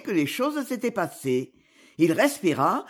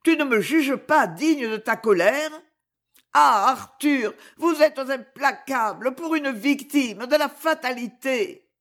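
anguished outcry; 6 semitones higher than usual. Acted. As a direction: cry out to him in anguish. Clémence pleads with Arthur. (cri d'angoisse, plus aigu, Arthur lent et fort)

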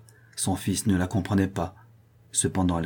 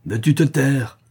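higher, sharp command to be silent; exasperated; 6 semitones higher, 4 dB louder than usual. A narrator's reading. Mother exasperated by daughter's weakness, sharp order. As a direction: cut off a weakling impatiently. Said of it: "dit la veuve exaspérée".

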